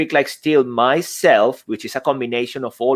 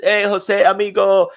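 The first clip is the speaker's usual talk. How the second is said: higher, friendly mock Spanish-accent greeting; kindly mimicked Spanish accent; 7 semitones higher than usual. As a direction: greet a pal in a playful imitation Spanish accent. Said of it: "mimicking my accent a little bit, but with kindness".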